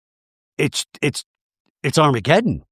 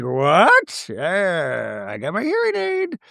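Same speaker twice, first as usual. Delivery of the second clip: drawn-out croon sliding up into a squeal; hammed-up hard-of-hearing caricature, swooping very high; mock a deaf old-timer with stretched, rising words; hard-of-hearing Mary; 4 semitones higher, slower than usual.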